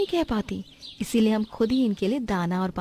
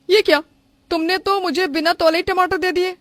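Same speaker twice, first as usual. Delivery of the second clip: high, loud customer complaint; indignant surprise; 8 semitones higher, 5 dB louder than usual. Acted. Customer questioning the seller over unweighed tomatoes, indignant surprise. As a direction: object sharply, higher and louder.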